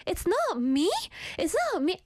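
high-pitched, exaggerated disbelief; mock shock. Acting out her reaction to not being picked as cutest, mock outrage. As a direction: act out incredulous protest, pitch leaping up.

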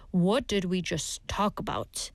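low, deliberate warning; slow and firm. Shown as a demonstration. Teacher showing how the phrase can sound like a warning, slow and firm. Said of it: "if you say it slowly and firmly".

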